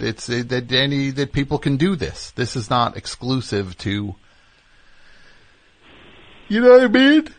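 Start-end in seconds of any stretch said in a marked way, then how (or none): 6.5-7.3 real yawn stretching the words, high and loud; talking through a yawn. Real, not acted. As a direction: yawn helplessly while you speak. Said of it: "why am I yawning".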